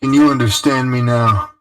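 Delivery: talking into a long tunnel; tin can phone. Acted. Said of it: "it sounds like you're talking into a, a can or something".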